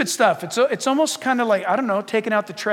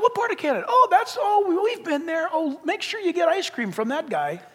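eager chat; 6 semitones higher than usual. Acted. Acted small talk between churchgoers.

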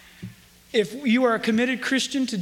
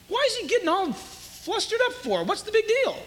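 much higher, puzzled mock questioning; 10 semitones higher than usual. Preacher voices someone wondering at Paul's fuss, high and puzzled. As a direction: ask it high, as a baffled onlooker.

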